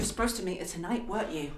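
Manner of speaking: soft, cold, low question; quiet accusation. Acted. Wife confronting her cheating fiancé, quietly accusing. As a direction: drop it down and let it sting.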